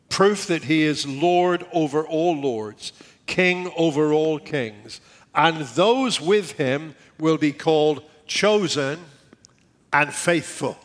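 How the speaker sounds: proclaiming scripture. Acted. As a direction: read it like a herald.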